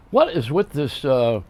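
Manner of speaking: ordinary talk, nothing marked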